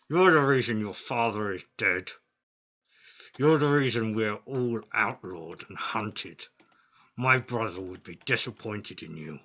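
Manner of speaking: loud furious monster accusation; angry minotaur. Acted. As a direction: bellow each insult at him. GM voices minotaur accusing player.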